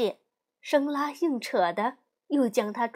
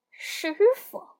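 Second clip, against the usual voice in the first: a higher, scornful monkey king; scoffing; 9 semitones higher, 3 dB louder than usual. Acted. Monkey King scoffing at Bajie's plea, scornful. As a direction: scoff at the word master.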